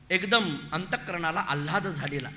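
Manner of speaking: usual speaking voice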